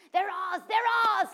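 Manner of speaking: high, loud joyful shout; excited shout. Retold or imitated. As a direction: cry it out with delight. Pastor claiming the refugees.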